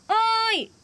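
high, ringing hail; calling out. Real, not acted. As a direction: call to someone across the grounds. Calling through the pipe.

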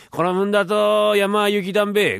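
rustic bumpkin twang on 雪だんべ; country dialect. Acted. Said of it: "寒いね".

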